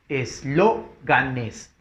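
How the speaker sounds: Eslóganes very slowly split as es-LÓ-ga-nes; syllable by syllable. Shown as a demonstration. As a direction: sound out Eslóganes with pauses, leaning on LÓ. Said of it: "Esdrújula lleva tilde". (Eslóganes silabeado muy despacio, con fuerza en LÓ)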